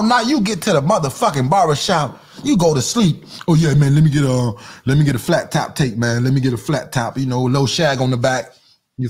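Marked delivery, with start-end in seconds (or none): 3.5-8.5 low, lazy put-on customer voice; mock sleepy customer. Mocking the customer who naps at the barbershop, low lazy voice. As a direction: lounge through the haircut order like a dozy client.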